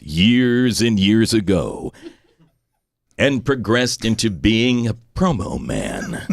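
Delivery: slow, booming epic trailer narration; movie trailer voice. Shown as a demonstration. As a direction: announce it like a blockbuster trailer. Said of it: "can you kindly give us a trailer voiceover".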